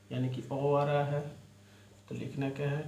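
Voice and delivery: ordinary delivery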